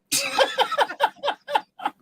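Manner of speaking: high peal of laughter; real laugh. Real, not acted. Joke teller after the rimshot, laughing. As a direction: laugh helplessly in a high pitch.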